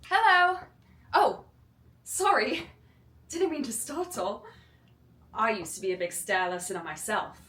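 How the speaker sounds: put-on high, bright British accent reading. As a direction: play it British, light and eager. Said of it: "tell me if my British accent's horrible".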